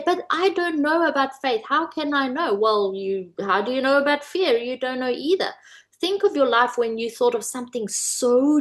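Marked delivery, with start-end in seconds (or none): 0.0-2.5 higher, doubting audience voice; doubtful listener. Retold or imitated. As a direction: voice the sceptic, pitched up. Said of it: "You're gonna say".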